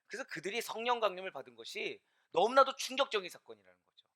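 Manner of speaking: ordinary talk, nothing marked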